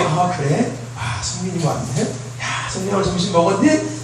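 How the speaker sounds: soft, kindly greeting; a lightly voiced gentle reply; gently. Pastor imitating the kind way a teen should answer, softly. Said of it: "막 부드럽게".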